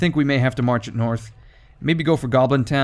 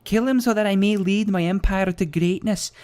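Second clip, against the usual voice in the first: higher Scottish woman's voice; 8 semitones higher than usual. Retold or imitated. Gamer reading the quest giver's regicide plea in Scottish. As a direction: read it as a scheming Scottish princess. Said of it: "apparently it's a Scottish woman".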